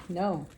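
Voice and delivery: ordinary talk, nothing marked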